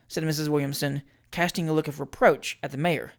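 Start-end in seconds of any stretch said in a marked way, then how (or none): none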